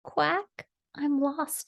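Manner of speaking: high, little lost duckling; small sad voice. A narrator's reading. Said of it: "he heard a small, sad voice".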